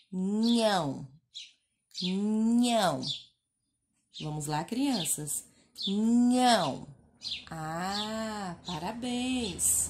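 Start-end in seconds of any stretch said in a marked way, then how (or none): 0.1-6.9 Nhão said loud and drawn out; sounding out Nhão. Shown as a demonstration. Teacher asking children to read Nhão. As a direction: model Nhão for kids to repeat. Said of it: "Como é que nós vamos ler?".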